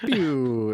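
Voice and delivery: drawn-out vocal sound of a machine switching off; a slow "pew" as the power dies; imitated power-down noise.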